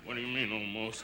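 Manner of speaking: very low, hushed repeat; forced calm. Acted. Policyholder repeating his question to the agent, forced calm. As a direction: drop it far down and soft, reining yourself in.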